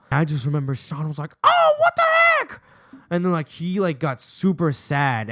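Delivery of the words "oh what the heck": high, loud squealed protest; indignant mock outcry. Sean hears he is suspended, shrieking mock indignation. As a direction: squeal it like an outraged kid.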